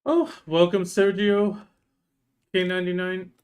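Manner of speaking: loud, high, bright welcome; excited greeting. Real, not acted. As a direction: call out the welcome warmly and loud. Streamer greets a new viewer.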